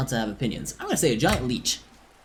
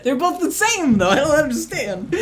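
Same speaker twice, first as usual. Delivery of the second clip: laughing; 5 dB louder than usual. Real, not acted. Laughing at the pronunciation joke.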